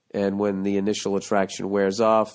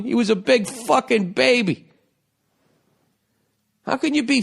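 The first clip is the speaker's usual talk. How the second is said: heated rant; 10 semitones higher, 3 dB louder than usual. Real, not acted. Ranting about a pouting man.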